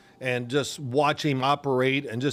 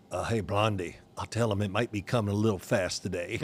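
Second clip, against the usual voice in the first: quieter, lower sly aside; low, teasing warning; 5 semitones lower, 5 dB quieter than usual. Retold or imitated. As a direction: receiver coach warning the receivers with a smirk. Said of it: "and he goes".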